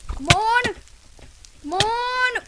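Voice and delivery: high, loud pleading yells; will the flames to finish him; urgent cheering. Real, not acted. Gamer urging the burning enemy to die, high loud yells.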